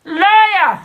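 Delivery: high, loud yell of liar; loud, high heckle of liar; shouted accusation. Real, not acted. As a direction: shout it across her. Shouting interruption at streamer.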